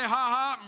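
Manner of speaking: loud, deliberate ha ha; forced laugh. Shown as a demonstration. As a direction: belt out a laugh for the crowd to copy. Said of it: "everybody say".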